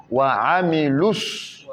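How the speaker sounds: Wa amilus slow, waa drawn out; Wa amilus with long wa. Shown as a demonstration. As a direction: stretch the wa of Wa amilus. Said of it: "il faut bien tirer".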